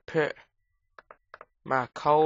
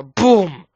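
mock explosion; 4 semitones higher, 12 dB louder than usual. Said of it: "a creeper's just gonna drop on my head and go".

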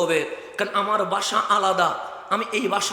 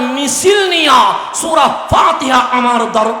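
slow, loud, drawn-out shout; booming slow proclamation; 4 semitones higher, 9 dB louder, slower than usual. Acted. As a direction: thunder each word slowly. Rising to peak of sermon. (ধীরে জোরে গর্জন)

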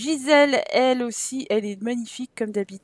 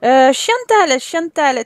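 calling out; 8 dB louder, much slower than usual. Acted. Calling the child over.

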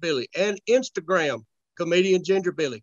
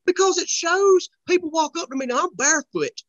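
high-pitched, comic exasperation; worked-up; 10 semitones higher, 5 dB louder than usual. Real, not acted. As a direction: protest it, riled up. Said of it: "the one that pisses you off".

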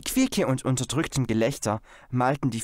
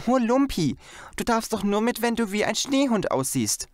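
desperate; 10 semitones higher than usual. A narrator's reading. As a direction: plead with the dog in despair. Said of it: "sagte Janet verzweifelt".

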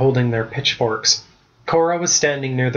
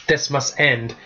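ordinary delivery

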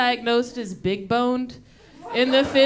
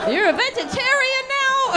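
much higher, fast mocking mom voice; mock shrill mom; 14 semitones higher than usual. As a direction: squeak it out like an outraged mom. Mom continues outraged.

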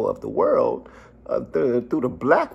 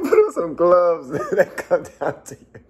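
quoting him through laughter; laughing quote; 3 semitones higher, 4 dB louder than usual. Retold or imitated. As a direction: repeat his line while cracking up. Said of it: "He said".

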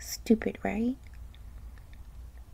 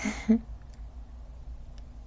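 short nervous laugh. Acted. Laughs while being questioned.

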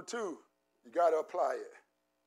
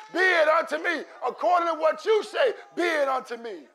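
loud, fervent preacher cadence with acted worshipper cries; full-force preaching; 6 semitones higher, 9 dB louder than usual. Pastor stirring the congregation to believe, preaching at full force. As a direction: preach it with rising fire.

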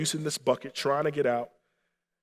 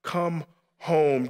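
usual speaking voice

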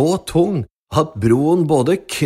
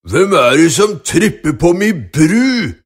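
loud troll bellow; troll screaming; 2 semitones higher, 5 dB louder than usual. Acted. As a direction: roar it like an angry troll. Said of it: "skrek trollet".

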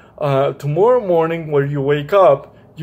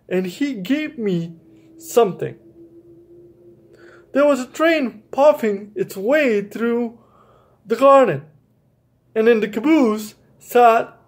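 gentle storybook reading voice; reading to children; 2 semitones higher than usual. A narrator's reading. Teacher reading a picture book to young listeners, gentle storytelling.

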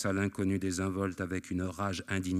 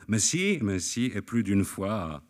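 cried out laughing; 5 dB louder, slower than usual. A narrator's reading. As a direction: toss it off gleefully, a touch of laughter. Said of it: "s'écria-t-il en riant". (s'écrier en riant, sans grand éclat)

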